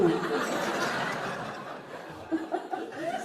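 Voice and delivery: airy gloating laugh, rising; mocking breathy laugh. Wife gloats at the other woman with an airy mocking laugh. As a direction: laugh in the rival's face. Wife mocks husband's mistress.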